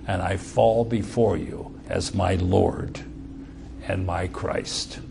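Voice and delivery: soft, low voice of reverent humility; humble. Retold or imitated. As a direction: confess unworthiness quietly to your Lord. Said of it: "expression of his humility".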